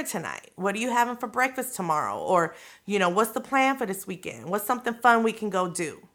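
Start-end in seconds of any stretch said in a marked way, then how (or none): none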